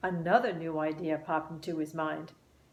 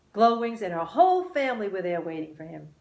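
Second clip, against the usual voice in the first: plain reading voice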